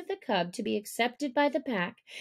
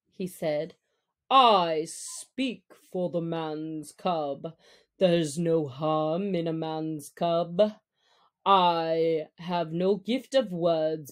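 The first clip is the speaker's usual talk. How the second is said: Baloo; 4 semitones lower than usual. Acted. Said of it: "rose upon his hind quarters and grunted".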